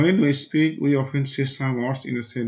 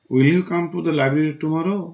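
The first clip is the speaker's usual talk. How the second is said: higher, louder, slow, leaning on "tomorrow"; stressed question read aloud; 4 semitones higher, 4 dB louder, much slower than usual. Shown as a demonstration. Teacher reads the library question to the class, stressing "tomorrow". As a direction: ask slowly, pressing "tomorrow". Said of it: "জোর দিয়ে বা একটু জোরে".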